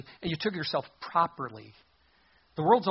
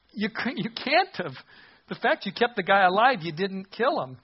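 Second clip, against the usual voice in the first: raised pitch, exasperated disbelief; incredulous objection; 4 semitones higher, 5 dB louder than usual. Acted. Preacher disputes Saul's claim of total destruction, incredulous. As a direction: argue back high and emphatic.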